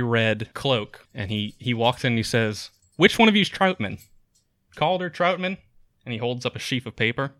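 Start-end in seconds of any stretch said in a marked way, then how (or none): 3.0-5.6 higher, raised voice of a newcomer; seeking a man by name, pitched up; calling out. Acted. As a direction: call across the inn for someone. Newcomer calls out for someone.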